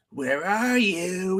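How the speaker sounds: slow, high, drawn-out searching call; high singsong taunt, retold; mock taunting call. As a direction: imitate a hunter calling out, stretching each word. Retelling attacker's taunt over chat.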